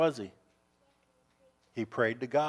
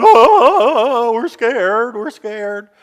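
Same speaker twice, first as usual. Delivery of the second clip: mock scared soldiers; 6 semitones higher, 12 dB louder, much slower than usual. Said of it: "all the guys went".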